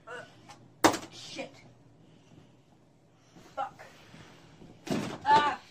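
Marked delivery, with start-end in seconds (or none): none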